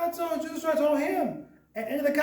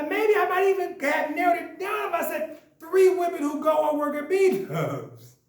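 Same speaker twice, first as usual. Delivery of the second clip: ordinary talk, nothing marked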